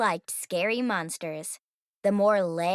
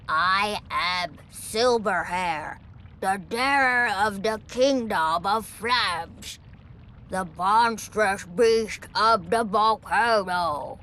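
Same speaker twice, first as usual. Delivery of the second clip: low, slow, booming dragon; slow, deep voice of a proud dragon; pompous dragon voice; 3 semitones lower, slower than usual. Acted. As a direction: announce yourself like a grand old beast. Dragon introduces himself to Zoe.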